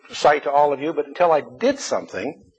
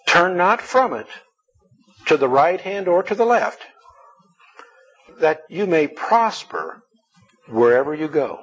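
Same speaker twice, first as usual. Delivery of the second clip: emphatic scripture reading; 5 semitones higher than usual. Acted.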